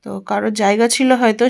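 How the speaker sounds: usual speaking voice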